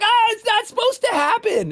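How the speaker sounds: hammed-up high panicked cry; mock panic. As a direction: squeal it out like a cornered husband. His panicked reply to wife.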